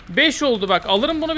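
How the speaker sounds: usual speaking voice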